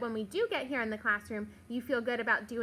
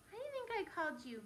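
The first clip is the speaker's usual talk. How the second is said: usual speaking voice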